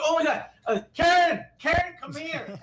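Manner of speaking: high, loud squeal of mimicked excitement; super excited. Store employee calling Karen over for the makeup video, super excited. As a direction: call out to a coworker, hammed-up and high-pitched. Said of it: "they got super excited".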